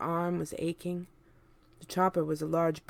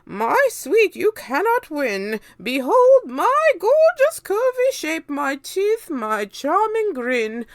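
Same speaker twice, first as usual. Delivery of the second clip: very high, loud preening aunt voice; a vain witch admiring herself, pitched way up; vain Aunt Spiker; 11 semitones higher, 13 dB louder than usual. A narrator's reading. Aunt Spiker boasting of her looks, high and loud. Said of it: "Aunt Spiker said".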